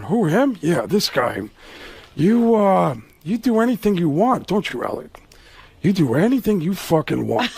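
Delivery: needling, sarcastic star with deliberate pauses; Al Pacino. Retold or imitated. Star eyeballing his rival, needling impression. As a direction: size him up scornfully, swinging the pitch. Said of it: "the whole time Pacino's looking, going".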